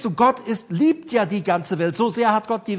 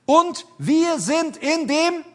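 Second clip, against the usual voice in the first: "Und wir sind in dem" high and drawn out; slow, high stress on each word of "Und wir sind in dem"; emphatic verse prompt; 7 semitones higher, much slower than usual. Real, not acted. Preacher prompts listeners with the verse, "Und wir sind in dem" slowly emphasized.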